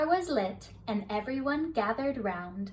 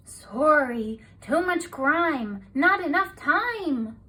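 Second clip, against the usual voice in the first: louder, slow and fed-up; harried busy voice; 4 semitones higher, 6 dB louder, slower than usual. A narrator's reading. Rudy on kitchen duty refusing Gil, slow harried voice. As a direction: groan it out while scrubbing.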